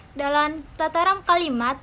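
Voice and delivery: usual speaking voice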